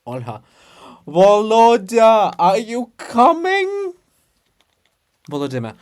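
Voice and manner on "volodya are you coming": loud, higher call, drawn out slowly. Acted. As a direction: call up to him from far below. Calling out to Volodymyr.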